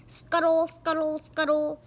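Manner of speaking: high quick cartoon chant; scuttling sound words. Narrator voicing the alien legs scurrying. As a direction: chant the scuttle noise playfully.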